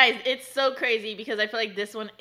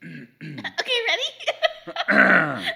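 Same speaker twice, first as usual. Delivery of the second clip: too loud; 2 semitones higher, 9 dB louder, much slower than usual. Acted. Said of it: "Wait, not so loud".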